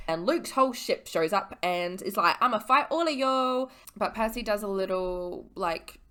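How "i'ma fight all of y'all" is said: higher, louder hammed-up challenge; mock threat. Ship's leader challenging the heroes, mock-menacing. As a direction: throw down a mock battle cry. Villain's threat voiced in retelling.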